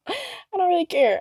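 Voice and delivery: laughing. Real, not acted. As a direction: toss it off with a laugh.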